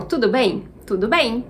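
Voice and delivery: ordinary talk, nothing marked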